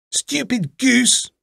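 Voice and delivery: very high, slow witch insult; scolding old witch. A narrator's reading. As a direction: draw it out high as the scornful witch. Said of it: "said the old woman".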